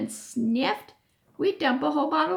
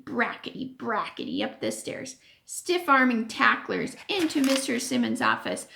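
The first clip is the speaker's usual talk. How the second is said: usual speaking voice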